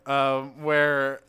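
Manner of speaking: ordinary talk, nothing marked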